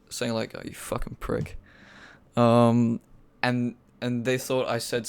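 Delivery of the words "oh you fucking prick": hushed, voiceless mumble; mumbled. Retold or imitated. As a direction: mumble the insult under your breath. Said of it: "I mumbled a little bit".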